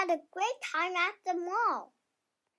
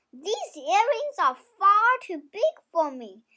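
plain reading voice

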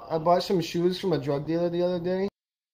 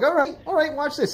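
high-pitched acted bravado; mock-confident retort, pitched way up; defiant comeback; 9 semitones higher than usual. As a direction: play a loser insisting he'll show her. Losing golfer insists he'll show her.

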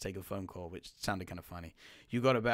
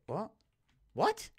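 shocked what; 12 semitones higher than usual. Real, not acted. Shock in game.

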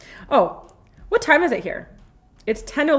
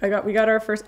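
normal talking voice

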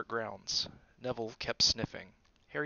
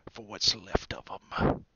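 nasty; 5 dB louder, slower than usual. A narrator's reading. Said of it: "he added nastily".

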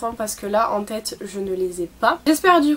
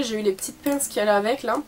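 usual speaking voice

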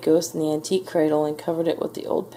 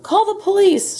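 very high, loud outburst; shouted demand; 5 dB louder than usual. A narrator's reading. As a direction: shout it in storybook outrage. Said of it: "shouted Mister Frizzle".

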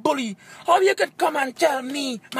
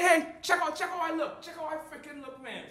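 normal talking voice

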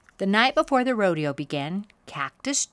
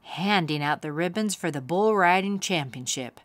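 ordinary delivery, nothing marked

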